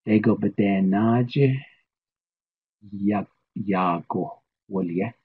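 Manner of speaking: "naje, ya, yago" drawn out slowly; slow Navajo statement. Shown as a demonstration. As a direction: model the sentence very slowly. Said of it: "let's try our statement".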